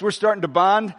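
ordinary delivery

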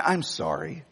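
dropped low and soft, sheepish sorry; low mock apology. Real, not acted. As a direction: shrink the voice right down. Apologizing for his boisterous voice.

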